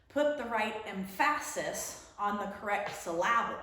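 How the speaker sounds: emphasis said em-FASS-is, syllable said suh-LAB-ul; silly misplaced stress. Shown as a demonstration. Teacher telling a funny joke, middle of emphasis and syllable stressed. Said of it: "you say it silly like this".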